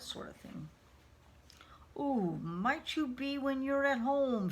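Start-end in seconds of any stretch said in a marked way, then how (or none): 2.0-4.5 higher, drawn-out hollow ghostly question; hollow spooky voice. A narrator's reading. Spirit girl trying to scare intruders, hollow voice. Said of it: "she inquired in her hollow voice".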